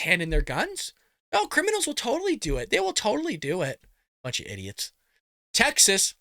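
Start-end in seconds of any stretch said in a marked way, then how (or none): 1.3-3.8 softer mock agreement, pitch swooping up; sarcastic. Mocking gun buyback idea. 4.2-4.9 low, quiet aside under the breath; dismissive mutter. Real, not acted. Dismissive aside after sarcasm.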